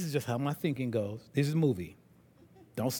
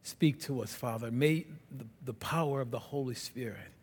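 closing prayer; 6 semitones lower, 5 dB quieter than usual. Real, not acted. Pastor leading the church in closing prayer, soft and reverent. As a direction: pray quietly over the congregation.

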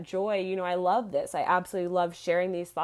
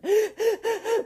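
sudden high laugh; high burst of laughter; 6 dB louder than usual. Real, not acted. Host cracking up mid-rant, high laugh.

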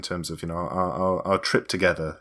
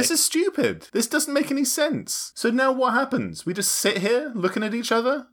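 much higher, exasperated complaining; 13 semitones higher than usual. Acted. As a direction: whine about the unfair decision. Host bickering over being removed.

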